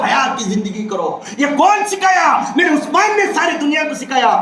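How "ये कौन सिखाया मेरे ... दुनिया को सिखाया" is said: high, shouted rhetorical question and answer; impassioned preaching. Real, not acted. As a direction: proclaim it with raised pitch. Preaches at full force.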